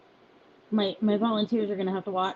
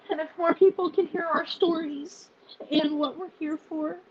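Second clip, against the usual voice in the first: higher, choked-up voice, pausing to hold back tears; real tearful voice; 7 semitones higher than usual. Real, not acted. Founder telling followers they're not alone, tearful. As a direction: fight through tears to get it out. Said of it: "I am an emotional wreck".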